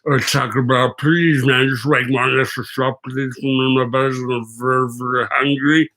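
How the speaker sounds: lightly voiced pleading drunk passenger; mock drunk rider. Driver mimicking a drunk rider begging for food, lightly pleading. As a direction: beg like a hungry drunk, half-hearted.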